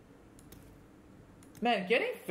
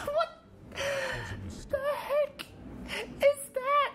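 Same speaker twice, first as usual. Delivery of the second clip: shocked high disbelief; much slower than usual. Real, not acted. Opponent's shocking play.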